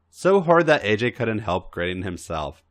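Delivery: ordinary delivery, nothing marked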